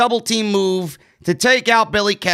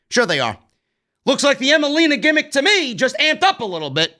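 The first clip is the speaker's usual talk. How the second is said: ordinary talk, nothing marked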